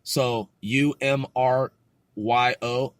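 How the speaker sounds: ordinary talk, nothing marked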